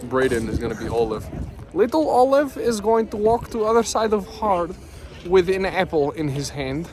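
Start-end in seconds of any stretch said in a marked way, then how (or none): 1.7-6.9 mock Russian accent. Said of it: "We were like".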